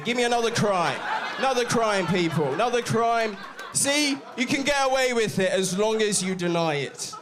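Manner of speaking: high, loud and triumphant crowd work; loud calling out. Real, not acted. Comedian proving denial works to audience, loudly calling out. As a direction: shout your point to the whole room. Comic working crowd, making his point.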